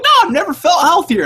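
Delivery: high, loud, insistent denial; mock Hillary denial. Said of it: "She's like".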